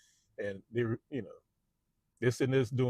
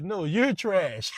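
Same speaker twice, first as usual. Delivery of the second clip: slow, higher and louder put-down; cutting insult; 8 semitones higher, 5 dB louder, much slower than usual. Retold or imitated. Boss throwing the insult back at salesmen, slow and higher. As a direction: drag out the insult to crush them.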